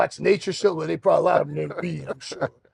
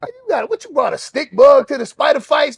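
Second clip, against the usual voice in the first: higher, scoffing disbelief with a whiny edge; mock him for bringing a bug; incredulous mockery; 7 semitones higher than usual. Inmates scoffing at his stick bug, higher and incredulous. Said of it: "And they go".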